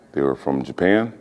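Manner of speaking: ordinary talk, nothing marked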